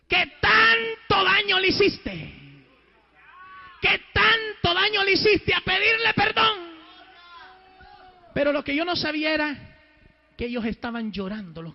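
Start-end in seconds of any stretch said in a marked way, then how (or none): 0.1-6.7 high, loud, slow preacher's shout; stretched, forceful reproach; retold divine rebuke. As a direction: thunder it out word by word.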